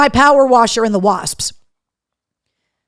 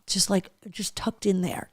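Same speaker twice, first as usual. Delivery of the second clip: breathy whisper; 5 semitones lower, 14 dB quieter than usual. Real, not acted. Muffled by humid weather, whispering.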